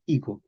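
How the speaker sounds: higo with a soft fricative G and silent H. Shown as a demonstration. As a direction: soften the G of higo, no H. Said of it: "Es una G fricativa". (higo con G fricativa)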